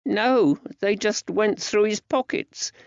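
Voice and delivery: put-on high-pitched small boy answering; child's high voice; young lad. A narrator's reading.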